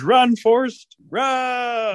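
high, drawn-out cheer; yelling after someone. Acted. Rabbit lets Forrest run on, yelling after him. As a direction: holler it high and stretch the last word.

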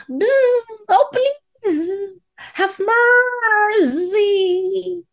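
high, crying insect plea; pleading fly voice. Acted. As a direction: beg for mercy like a tiny fly. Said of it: "the fly implored".

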